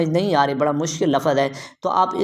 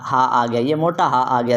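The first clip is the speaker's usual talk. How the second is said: ordinary delivery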